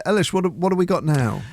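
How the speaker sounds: usual speaking voice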